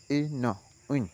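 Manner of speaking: ordinary talk, nothing marked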